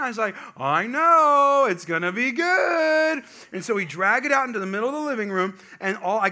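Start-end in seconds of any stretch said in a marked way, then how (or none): 0.6-3.2 high, loud, drawn-out teasing hype; playful hype. Retold or imitated. Dad to kids about the big present, hammed-up excitement. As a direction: stretch it out, hammed-up anticipation.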